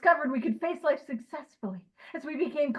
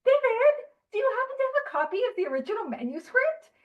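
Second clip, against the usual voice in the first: high, fast pedantic asking; mock nerdy question; 10 semitones higher, faster than usual. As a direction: put on a prissy high voice asking for the manuscript. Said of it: "And I'm like".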